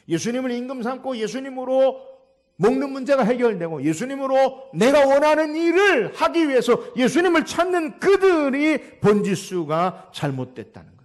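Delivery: impassioned sermon delivery; forceful preaching. Real, not acted. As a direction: drive the point home with stretched words.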